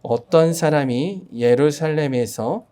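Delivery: slow, measured Bible reading; scripture reading. Acted. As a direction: read the verse slowly and solemnly.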